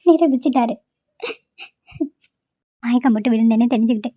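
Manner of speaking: hurt, sobbing lament to herself; crying. Acted. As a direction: choke out the grievance between sobs. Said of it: "இந்த பொண்ணு ஏன் இப்படி அழுகுது". (அழுதுகொண்டே மனம் நொந்து புலம்பல்)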